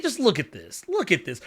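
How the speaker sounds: usual speaking voice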